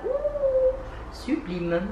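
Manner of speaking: very high, squealed ooh of delight. Real, not acted. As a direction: squeal at the sparkle. Admiring the shimmer. (« Ouh » très aigu, émerveillé)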